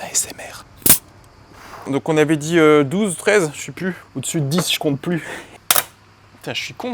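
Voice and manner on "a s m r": ASMR whisper. Pretend. The mechanic jokes to viewers in an ASMR whisper. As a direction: whisper close to the mic. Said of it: "on va le faire en A S M R".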